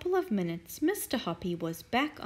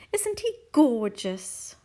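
gushing Mrs Silver; 3 semitones higher, 6 dB louder than usual. Acted. Mrs Silver gushing about tortoise.

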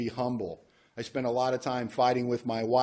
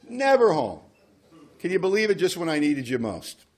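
high, louder outrage at God on Never home; mock indignant complaint; 5 semitones higher, 4 dB louder than usual. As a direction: whine about being abandoned, half-joking. Mock complaint that God's absent.

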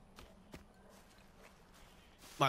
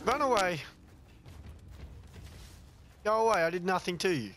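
scared pleading; 7 semitones higher than usual. Real, not acted. Fleeing an enemy in game.

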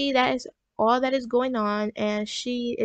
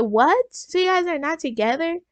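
louder, higher mock-incredulous question; imitated parental disbelief, pitched up; retold shock; 5 semitones higher, 4 dB louder than usual. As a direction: act out a parent taken aback. Retelling parent's shocked reaction.

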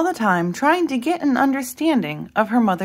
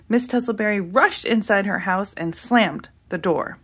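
ordinary delivery, nothing marked

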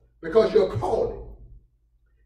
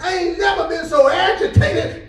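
Paul complaining; 7 semitones higher than usual. Acted. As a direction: cry out like a man worn down. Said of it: "Paul says it like this".

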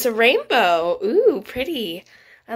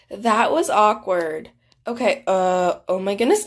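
ordinary delivery

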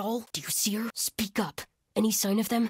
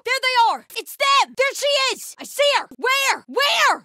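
high, loud alert cry; urgent, high-pitched combat callout; shouted sighting; 8 semitones higher, 6 dB louder than usual. Acted. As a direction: yell to the others on spotting the target. Spotting the enemy, calling out.

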